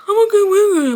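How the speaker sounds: high-pitched mocking simper; mocking a grovelling employee; mock eager worker. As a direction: put on a high, pleading little voice. Mocking a pleading worker she won't be.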